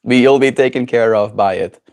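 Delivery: ordinary talk, nothing marked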